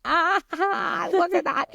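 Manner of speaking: high-pitched delighted coo; delighted geeking out. Acted. American geeking over co-host's accent, delighted squeal. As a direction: marvel giddily, voice lifted well up. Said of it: "As an American, I'm like".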